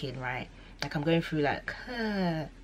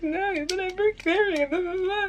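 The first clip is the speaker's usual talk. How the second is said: high whiny protest. Retold or imitated. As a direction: whine it back, dragging out. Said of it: "I'm like".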